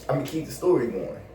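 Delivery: ordinary talk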